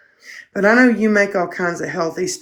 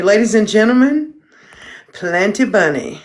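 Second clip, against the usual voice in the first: mock announcer; 3 semitones higher than usual. Introducing the dog like a show.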